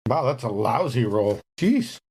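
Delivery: usual speaking voice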